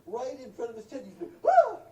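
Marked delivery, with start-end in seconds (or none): 1.5-1.8 short, piercing loud shriek; terrified mock scream. Camper meeting the moose at his tent, shrill scream. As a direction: let out a high yelp of fright.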